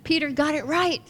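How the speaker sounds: Jesus thrilled Peter answered right; excited. Retold or imitated. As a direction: burst out pleased. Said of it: "He was so excited".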